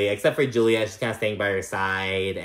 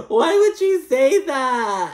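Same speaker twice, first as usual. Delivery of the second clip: high, loud, drawn-out wail through laughter; laughing disbelief; 14 semitones higher, 6 dB louder, much slower than usual. Real, not acted. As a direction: cry it out while cracking up. Cracking up over Adriana's remark.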